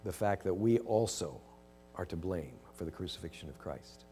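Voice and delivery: gentle closing prayer. Real, not acted. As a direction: pray quietly to close.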